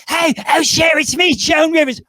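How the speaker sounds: high, loud celebrity caller impression. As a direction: shout it down the line in a high put-on voice. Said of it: "they would call up [name] and they'd say".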